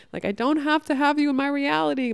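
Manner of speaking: ordinary delivery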